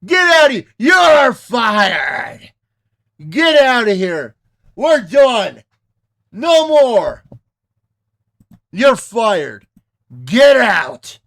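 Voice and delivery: angry yelling, slow and loud; furious shouting. Pretend. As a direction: shout them off the screen. Yelling the speakers off screen.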